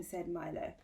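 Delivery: ordinary delivery, nothing marked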